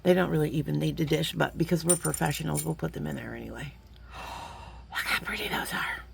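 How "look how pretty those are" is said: whisper. Real, not acted. Gasp then whisper.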